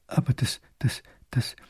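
breathless whispered stammer; stunned, can't get the words out. A narrator's reading. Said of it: "kann ... nur stottern". (fassungslos geflüstertes Stottern)

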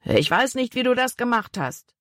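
mildly threatening, pitched high. A narrator's reading. Big man threatens boy on board, mild menace. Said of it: "drohte dem Jungen".

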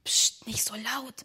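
urgent whisper; whispered hush. Acted. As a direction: shush them under your breath. Hushing the excited child. (geflüstert, zur Ruhe mahnend)

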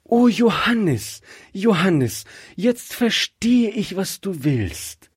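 high, thrilled cries of his name; excited. Acted. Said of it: "Rebekka war ganz aufgeregt".

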